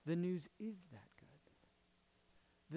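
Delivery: drawn-out, soft "The news is that good"; slow, weighty delivery, softly spoken; slow, hushed emphasis. Real, not acted. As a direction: let each word of "The news is that good" land slowly and quietly. Key line said slowly, then repeated.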